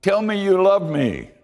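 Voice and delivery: a dancing man begging God to say it; high, giddy Gideon begging to hear he's loved. Acted. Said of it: "and he says".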